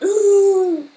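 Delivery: high, loud drawn-out "ooh"; imitated ooh. Streamer showing her reaction to the gift, loud high ooh. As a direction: re-enact a startled, high-pitched ooh. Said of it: "I literally went".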